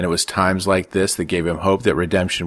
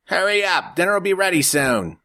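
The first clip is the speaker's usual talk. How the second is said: higher, called across the house; mother calling to her sons; 13 semitones higher than usual. A narrator's reading. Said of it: "their mother called out".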